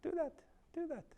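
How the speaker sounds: ex-wife's scolding that dwindles into a high murmur; mimicking the ex-wife. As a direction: start a high scold, let it fade nearly to nothing. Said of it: "She'd be like".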